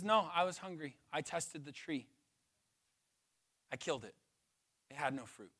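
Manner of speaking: low, soft and flat-out calm; Jesus answering softly and low; quiet, matter-of-fact Jesus. Acted. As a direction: answer them quietly, no apology. Jesus voiced answering calmly.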